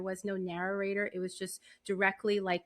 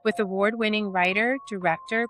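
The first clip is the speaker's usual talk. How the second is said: polished announcer read over music, louder than her chat; podcast intro read; 8 dB louder than usual. Acted. Host opening her horror podcast for listeners, smooth announcer read. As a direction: present the show like a radio host, warm and measured.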